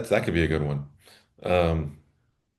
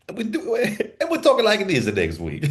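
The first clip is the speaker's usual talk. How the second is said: laughing. Real, not acted. Laughing at their own joke.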